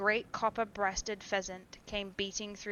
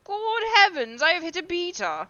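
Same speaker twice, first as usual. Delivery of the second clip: much higher, loud cry of alarm; shocked exclamation; 11 semitones higher, 10 dB louder than usual. A narrator's reading. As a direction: gasp it out in horror. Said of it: "exclaimed Sir Geoffrey".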